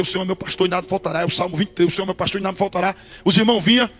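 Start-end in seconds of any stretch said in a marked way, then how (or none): 0.0-1.2 fast rehearsing mutter. Retold or imitated. Nervous before preaching, he rattles the verse off fast and soft. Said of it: "Sem parar, pra não esquecer". 1.9-2.9 fast rehearsing mutter. Retold or imitated. Nervous before preaching, he rattles the verse off fast and soft. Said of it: "Sem parar, pra não esquecer".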